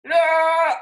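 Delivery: loud, high playful cry; high mock battle cry; wild yell. A narrator's reading. Octopus attacks with paintbrushes.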